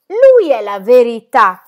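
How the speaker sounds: slow, loud, bitter declaration. Acted. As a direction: hammer it out slowly to the crowd. Bitter climax before the assembly.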